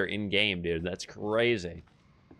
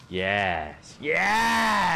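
triumphant shout; 5 semitones higher than usual. Real, not acted. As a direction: roar it out in victory. Burning the doll, triumphant.

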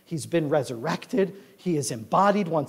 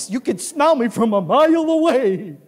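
high, loud gleeful boast; proud, excited dog; 7 semitones higher, 7 dB louder than usual. Acted. Dog boasts of new smell to owner, gleeful. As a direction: crow it like a delighted dog.

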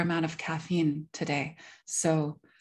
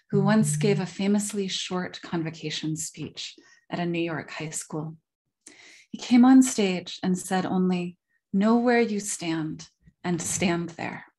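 measured lecture reading, phrase openings punched louder; reading a prepared talk; 5 dB louder than usual. Real, not acted. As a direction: read it out formally, leaning hard into each new sentence. Reads a prepared paper.

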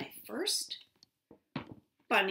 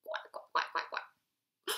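whispered quacking; slower than usual. Acted. Imitating duck sounds.

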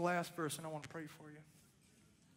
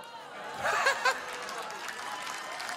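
breathy laugh. Real, not acted. Laughs at tumor falling off.

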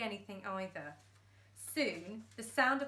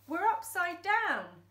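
higher, louder retort; exasperated; 8 semitones higher, 7 dB louder than usual. Acted. Dad answering Thumbs, exasperated. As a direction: point out the obvious. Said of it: "said Dad".

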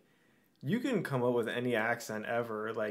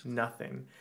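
ordinary talk, nothing marked

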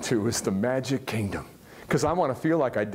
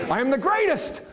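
proud champion's boast; Ali boast; 10 semitones higher than usual. Retold or imitated. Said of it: "What did he say about himself every day?".

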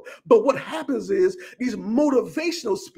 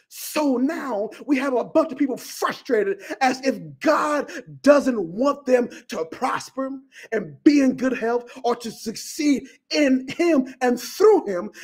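ordinary talk

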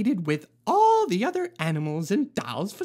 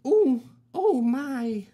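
dazed oh my; 3 dB louder, slower than usual. Acted. After the lamp crashes.

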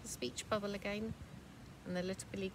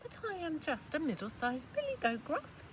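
higher pleading goat voice; 8 semitones higher than usual. Acted. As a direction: beg to be spared. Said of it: "said the middle-sized billy goat Gruff".

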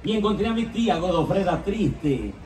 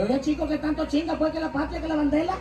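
Cuban woman; 10 semitones higher, 4 dB quieter, faster than usual. Acted. La negra contesta al cubano.

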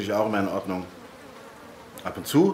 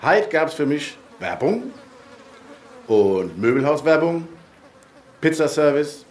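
rural dialect. Acted. Mailbox speaks in dialect.

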